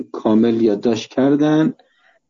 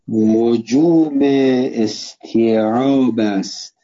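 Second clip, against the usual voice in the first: very slow, measured dictation in short chunks; much slower than usual. Real, not acted. Teacher dictating a text for students to write down, slow dictation. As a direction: dictate word by word with long pauses.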